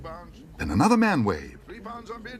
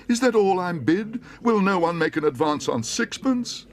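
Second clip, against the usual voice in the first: high, louder pleading; desperate auctioneer; 11 semitones higher, 4 dB louder than usual. Acted. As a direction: beg the room for more.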